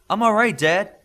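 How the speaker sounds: loud high reassurance; calling out. Acted. Joseph calls across the tent to his father, loud and reassuring. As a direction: call to Dad across the room before he asks.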